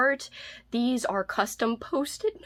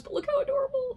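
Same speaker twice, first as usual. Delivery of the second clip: cutesy coo; faster than usual. Real, not acted. Gushing over the mice book.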